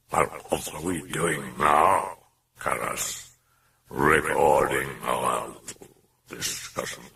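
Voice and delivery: slow, low, breathy demon voice; sinister murmuring demon. A narrator's reading.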